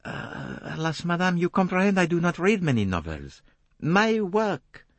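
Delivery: a polite Belgian detective asking; polite French accent. A narrator's reading. Poirot voiced by the narrator.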